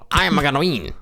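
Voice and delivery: exaggerated game-character voice; Wario. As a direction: crow it like a goofy cartoon villain. Wario catchphrase acted out.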